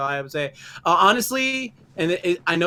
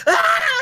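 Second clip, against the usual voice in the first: short, very high shrieking cry; a kid frightened by his dad, shrieking; mock scream; 7 dB louder than usual. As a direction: let out a cartoon yelp of terror. Bart screams in retold scene.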